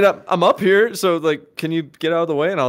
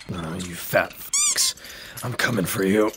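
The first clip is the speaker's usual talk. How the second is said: strained low threat; 12 semitones lower, 9 dB quieter than usual. Acted. Hero still coming for Patrick, low muttered threat. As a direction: grumble it to yourself mid-struggle.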